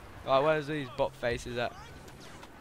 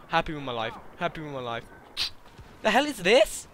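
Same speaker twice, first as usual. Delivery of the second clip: ordinary talk, nothing marked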